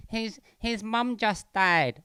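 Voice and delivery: put-on a whiny pleading girlfriend voice, drawn out; high, slow mock girlfriend. Girlfriend pleading for the heckler, high and slow. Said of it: "his girlfriend or something was like".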